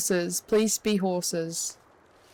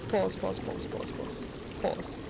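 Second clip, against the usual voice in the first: from plain to a softer, then whispered pause; fading to whisper; 2 semitones lower, 8 dB quieter than usual. Real, not acted. Streamer hushes his viewers after hearing footsteps, fading to a whisper. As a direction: trail off into a tense whisper. Hears something scary in game.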